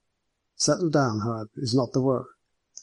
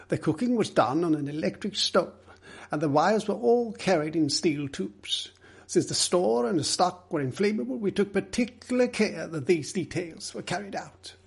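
firm, higher-pitched character voice; firm reply; 6 semitones higher than usual. Acted. Middleton defending the store's safety details, firm. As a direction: insist firmly that every care was taken. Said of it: "Middleton replied firmly".

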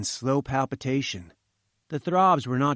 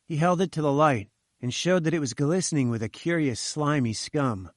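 ordinary delivery

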